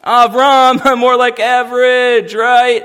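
a heckler's loud mocking sing-song; mocking smart aleck. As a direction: taunt him like a schoolyard wise guy. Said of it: "the jokes that he has to hear again and again from the smart Alex".

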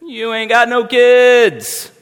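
a heckler's loud mocking sing-song; mocking smart aleck. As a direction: taunt him like a schoolyard wise guy. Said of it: "the jokes that he has to hear again and again from the smart Alex".

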